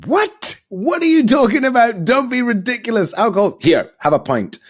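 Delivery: hammed-up colleague pushing a pint; incredulous colleagues. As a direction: scoff like a boozy colleague. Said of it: "they were like".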